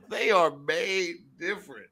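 slow, deliberate wording through a laugh; slow, amused emphasis. Real, not acted. Repeats the remark slowly for emphasis.

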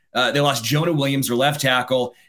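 ordinary talk

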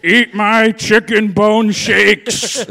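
loud, high, very slow bellow; Alex Jones. Acted. Joining the seventy-foot ranter riff, loud drawn-out impression. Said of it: "a seventy-foot Alex Jones".

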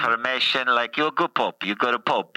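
put-on Italian accent as the Pope; thick Italian-English accent, playing the Pope; comic Italian accent as the Pope.

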